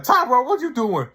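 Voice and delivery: high, loud retold yell; mock shout. Rhonda yelling at Tyrone in the car, high mock shout. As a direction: yell his name up high, incredulous. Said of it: "she's like".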